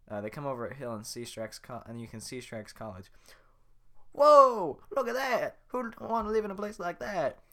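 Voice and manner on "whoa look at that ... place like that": excited Donkey. Acted. Donkey sees swamp.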